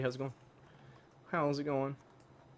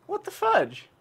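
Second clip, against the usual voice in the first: much higher, louder outraged squeal; shocked squeal; 6 dB louder than usual. Real, not acted. Gamer stunned by an enemy's sudden move. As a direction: yelp in disbelief.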